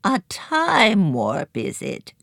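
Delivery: croaking crone. A narrator's reading. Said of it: "croaked Sybil Hunch".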